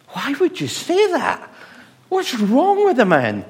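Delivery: high, loud mock disbelief; incredulous. Real, not acted. Preacher exclaiming about Simon's request, high and incredulous. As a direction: throw up your hands in disbelief.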